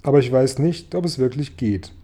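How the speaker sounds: ordinary delivery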